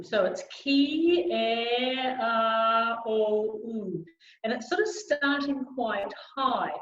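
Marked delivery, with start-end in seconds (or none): 0.5-4.0 slow, joined-up "ki, e, a, o, u" on a level tone; connected vowel sequence. Shown as a demonstration. Coach modelling "ki, e, a, o, u", connected and sustained. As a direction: run "ki, e, a, o, u" together, fading at the end. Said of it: "the vowel sequence I love to use".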